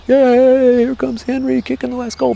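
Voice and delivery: high, excited mock soccer commentary; World Cup commentator. Boy imagines himself in the World Cup, high excited commentary. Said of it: "imagines he's in a World Cup".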